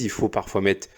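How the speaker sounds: usual speaking voice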